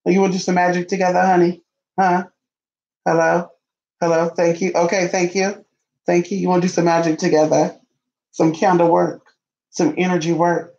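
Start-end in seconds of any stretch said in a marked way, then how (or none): none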